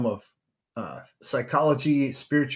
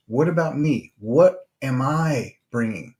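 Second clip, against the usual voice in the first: ordinary talk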